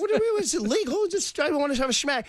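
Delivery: high, squeaky mock protest; indignant caddy. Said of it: "They're like".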